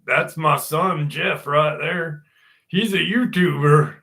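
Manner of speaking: slow, drawn-out imitation of dad; proud dad impression. Dad introducing his son to the doctor, mock proud.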